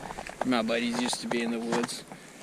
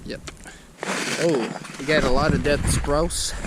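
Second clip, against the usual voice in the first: ordinary talk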